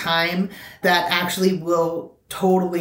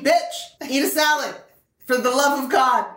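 higher, bossy voice of her own body; body scolding her; 7 semitones higher than usual. Retold or imitated. Body telling her to eat a salad, bossy. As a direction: let your body scold you like a sassy friend. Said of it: "My body's telling me".

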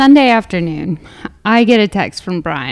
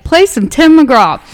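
imitating Brian; 7 semitones higher, 5 dB louder, slower than usual. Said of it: "Brian's like".

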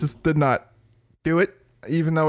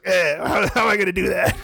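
dad's voice; 2 semitones higher than usual. Retold or imitated. Dad quoted in story.

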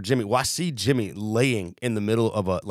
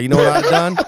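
louder, punchy retold boast; loud cab driver quote; 4 semitones higher, 8 dB louder than usual. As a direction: mimic a brash cab driver.

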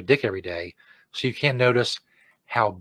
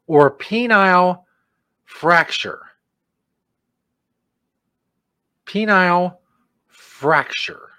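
slow, higher-pitched dramatic term; mock announcer; 6 semitones higher, 4 dB louder, much slower than usual. Host repeating a side effect, slow mock-dramatic announcer. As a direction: draw out each word for comic weight.

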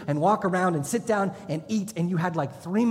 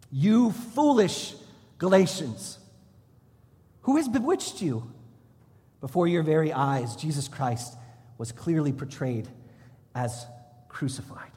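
scripture reading. Acted. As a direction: read the verse like a rebuke. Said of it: "Galatians three one".

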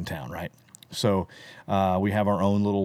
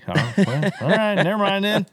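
louder, higher, laughing as he gives up; laughing never mind; 11 semitones higher, 4 dB louder than usual. Retold or imitated. Snubbed newcomer backing off, loud and laughing. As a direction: laugh off the cold shoulder. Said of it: "And I'm like".

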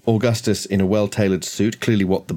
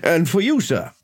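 higher-pitched householder taking orders; courteous host; 5 semitones higher than usual. Acted. Host orders drinks from Rosemary for his guests, courteous high voice. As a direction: politely relay a drinks order, voice raised in pitch.

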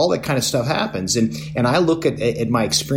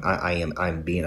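usual speaking voice

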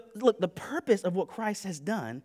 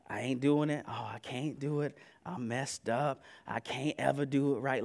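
despairing believer; 7 semitones lower, 7 dB quieter than usual. Acted.

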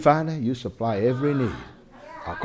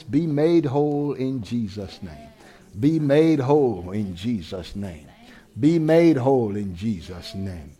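healing prayer; 4 semitones lower than usual. Real, not acted.

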